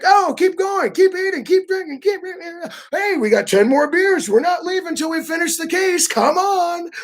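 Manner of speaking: rally the table to keep going; relentless host. Retold or imitated. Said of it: "they still won't stop".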